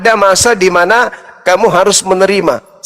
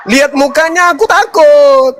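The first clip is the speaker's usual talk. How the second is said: mock fear, laughing; 8 semitones higher than usual. Mock complaint about wife.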